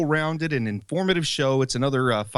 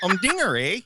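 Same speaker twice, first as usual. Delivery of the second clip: put-on Canadian accent; 4 semitones higher than usual. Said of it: "do your best Canadian humdinger voice".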